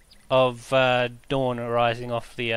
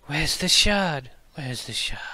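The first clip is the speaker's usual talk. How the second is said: echoing voice. Acted. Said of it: "it's almost echoed slightly behind on the other side".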